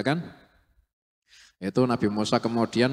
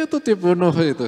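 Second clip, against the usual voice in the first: laughing; 9 semitones higher, 8 dB louder, faster than usual. Real, not acted. Laughing while telling the killing.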